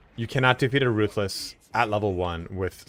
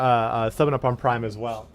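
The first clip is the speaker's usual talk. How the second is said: ordinary talk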